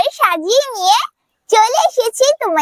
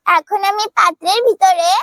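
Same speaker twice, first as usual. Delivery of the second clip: usual speaking voice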